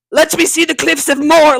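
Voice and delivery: high, loud old Irishman imitation; old Irish granddad. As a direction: holler like an Irish grandpa demanding his view. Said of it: "he's this old Irish".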